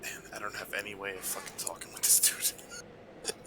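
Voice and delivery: whispered aside to friends. Real, not acted. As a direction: hush it so nobody hears.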